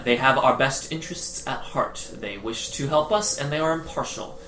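soft, measured, barely audible reply; calm. Acted. Woman answering her yelling brother behind a door, calm and quiet. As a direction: keep it quiet and even. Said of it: "obviously calm and normal voiced".

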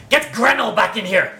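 gruff goblin order. Acted. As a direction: call the order through the door. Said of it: "and another voice says".

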